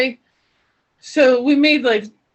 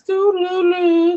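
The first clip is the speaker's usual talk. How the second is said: idle scrolling hum; 8 semitones higher, 3 dB louder, slower than usual. Shown as a demonstration. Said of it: "I'm over here like this".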